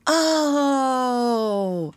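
high, falling, drawn-out "oh"; children's awed cry; amazed "oh". Acted. Children marvel at the reveal.

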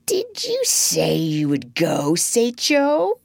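musing question with veiled menace. A narrator's reading. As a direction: ask as if idly wondering. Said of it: "he said musingly".

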